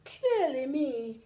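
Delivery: slow put-on leopard; secret thought. A narrator's reading. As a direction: think it smugly. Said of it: "secretly thought the leopard".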